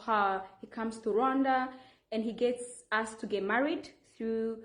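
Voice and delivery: ordinary talk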